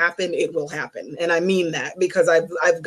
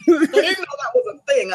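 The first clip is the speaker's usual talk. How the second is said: laughing surprise; 8 semitones higher than usual. Retold or imitated. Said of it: "I'm like".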